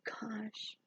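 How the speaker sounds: barely voiced, soft "Gosh."; a tiny polite "Gosh." with no feeling; limp gosh. Shown as a demonstration. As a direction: mumble "Gosh." almost silently. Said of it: "I don't say it".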